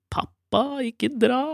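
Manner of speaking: mild mock cry to Dad; imitated pleading child. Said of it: "hun liksom roper".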